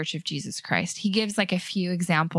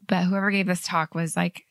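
ordinary talk

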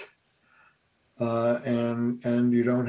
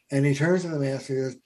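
ordinary talk

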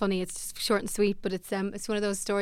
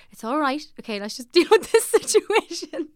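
reassuring; 6 semitones higher, 8 dB louder than usual. Retold or imitated. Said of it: "like, trying, like".

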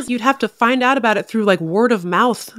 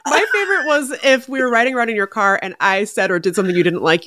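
usual speaking voice

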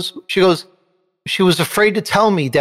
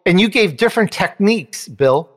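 ordinary talk

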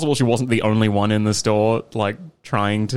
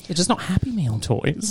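higher, slow teasing drawl; joking dig at a friend, high and slow; 8 semitones higher, 3 dB quieter than usual. Acted. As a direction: rib her gently, slow and high.

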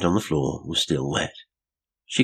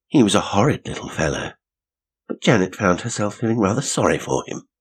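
plain reading voice

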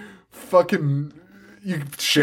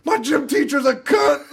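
mock teen yell; 11 semitones higher than usual. Mocking the girls walking home.

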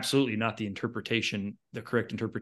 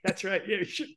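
laughing; 10 semitones higher than usual. Retold or imitated. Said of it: "someone's like".

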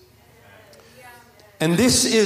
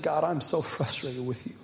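tearful prayer; 5 semitones lower, 9 dB quieter than usual. Retold or imitated. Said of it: "going to God once in tears".

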